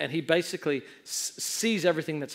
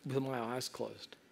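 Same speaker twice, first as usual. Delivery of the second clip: low, hushed retold resolve; steady yourself softly before the jump; soft, lowered inner voice; quiet self-talk; 5 semitones lower, 8 dB quieter, faster than usual. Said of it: "so I just say".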